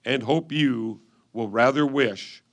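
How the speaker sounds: ordinary delivery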